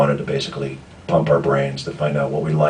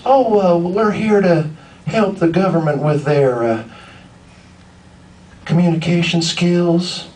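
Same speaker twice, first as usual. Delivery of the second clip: high Southern drawl, imitating a woman; Holly Hunter Southern accent; 12 semitones higher than usual. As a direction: mimic her high-pitched Southern accent, stretching the last words. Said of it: "She said it just like that".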